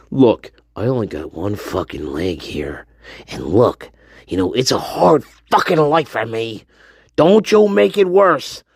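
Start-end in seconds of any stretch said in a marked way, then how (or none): 0.1-6.6 low mock pitiful voice.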